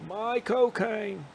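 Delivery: hammed-up Caine impression; mock Michael Caine. Buddy punning on Caine's name, high and slow. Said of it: "Michael Caine impressions all night".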